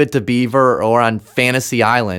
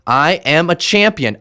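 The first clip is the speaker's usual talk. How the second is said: emphatic, driving declaration; firm, energized affirmation; thrust and energy; 4 semitones higher than usual. Shown as a demonstration. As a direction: say it like you own it. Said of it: "Say it with real thrust and energy".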